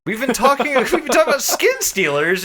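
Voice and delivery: high, loud and eager retelling; bubbling over while recapping; giddy storyteller voice; excited. Real, not acted.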